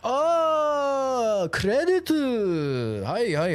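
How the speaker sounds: high, drawn-out imitation of a Japanese clerk catching on; Japanese accent. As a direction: mimic dawning understanding in a Japanese accent. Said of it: "They speak in the accent".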